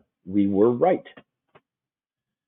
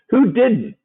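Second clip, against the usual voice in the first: much higher, louder playful ask; host teasing viewers who missed the trade, pitched up high; teasing question; 13 semitones higher, 5 dB louder than usual. Real, not acted. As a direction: toss it up high like a tease.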